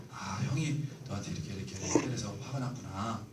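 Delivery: soft, understanding whisper; a mother quietly naming her son's feelings; gentle whisper. Retold or imitated. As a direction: hush and comfort the child. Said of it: "감정 읽어주는 거죠".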